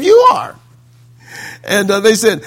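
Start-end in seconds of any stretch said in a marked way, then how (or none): 0.0-0.6 high-pitched comeback; acted, high argumentative jab; mock retort. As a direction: throw the accusation back high.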